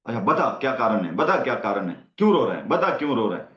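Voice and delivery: quick, pushy demand for the reason; impatient parent pressing. Retold or imitated. Impatient mother pushing her crying child for a reason, quick. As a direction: rattle off the questions like an impatient mother.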